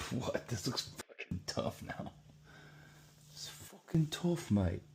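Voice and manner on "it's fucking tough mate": much higher, slow hammed-up Aussie-style accent; bad mock accent. Shown as a demonstration. Admiring his drawing, in a bad mock Australian accent. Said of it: "That's a really bad accent".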